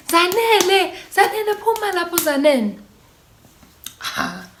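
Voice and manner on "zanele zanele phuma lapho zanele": high-pitched mock call of a worried mother; mom calling out. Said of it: "So she's like".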